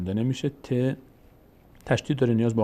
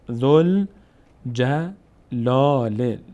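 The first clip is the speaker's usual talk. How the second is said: each syllable drawn out, pause between; slow syllable-by-syllable reading; 3 dB louder, much slower than usual. Shown as a demonstration. Teacher models exercise words for students, slow syllable-by-syllable reading. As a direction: sound it out slowly for learners to repeat. Teacher demonstrates joined word slowly.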